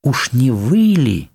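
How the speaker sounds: slow question with a high rise; astonished guess. Acted. Guest realizing the count was the man, astonished. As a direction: draw it out in dawning surprise.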